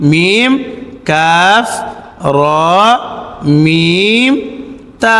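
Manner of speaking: each letter name stretched, as in "মিম"; drawn-out letter names. Shown as a demonstration. Teacher lists the word's letters, each like "মিম" drawn out. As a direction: say "কাফ" long and separate. Naming letters one by one for learners.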